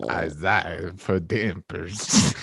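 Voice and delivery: low, slow vampire voice. Acted. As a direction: draw the words out like a vampire. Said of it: "vampire impression".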